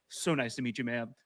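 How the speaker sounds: soft, low, polite and serious; suddenly earnest and polite. Retold or imitated. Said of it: "serious face".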